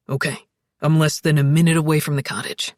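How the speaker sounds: low, quick man's voice; deep voice, brisk pace; steady, urgent Alex. A narrator's reading. Alex hurries to help Zoe.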